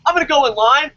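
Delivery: ordinary talk, nothing marked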